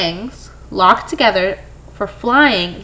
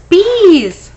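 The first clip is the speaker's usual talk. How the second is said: excited cry; 6 semitones higher, 8 dB louder than usual. Acted. Cheerful finale to a list.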